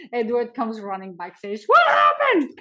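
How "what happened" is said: high, loud alarmed question; alarmed director. Retold or imitated. Edward rushing backstage to the crying dancer, high alarmed shout. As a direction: burst in asking, much higher and louder.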